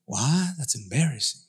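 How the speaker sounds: a hint of a shy churchgoer; embarrassed listener. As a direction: mumble like it's awkward. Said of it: "If I was to ask you".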